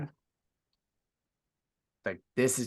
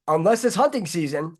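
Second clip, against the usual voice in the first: ordinary delivery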